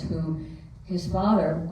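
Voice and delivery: usual speaking voice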